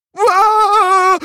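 high, loud yell of fright; scared yell. Acted. Muk facing the scary dinosaur, scared yell. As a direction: yell in panic. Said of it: "riep Muk".

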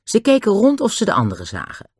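ordinary delivery

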